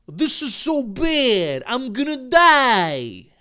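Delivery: slow, high, loud mock wail; hammed-up despair. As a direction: wail it like a melodramatic victim. Dave's acted melodramatic despair.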